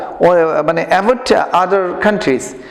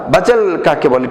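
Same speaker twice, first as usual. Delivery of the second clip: usual speaking voice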